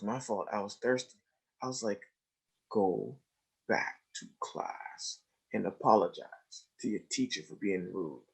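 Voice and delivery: quiet, low continued whisper; murmured exchange; hushed talk. Retold or imitated. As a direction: keep it low and private. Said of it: "like, whisper".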